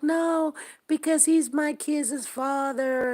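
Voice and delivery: high-pitched retold objection; defensive protest. Daughter defending herself over the condom question, high protest.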